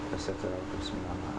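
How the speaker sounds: very quiet, low murmured invocation; hushed prayer. Real, not acted. Player afraid of the man in the game, hushed low prayer. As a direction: pray under your breath, warily.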